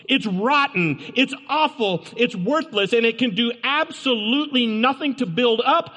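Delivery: sermon climax against foul talk; preaching. Acted. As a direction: drive each short verdict home. Preaching condemnation.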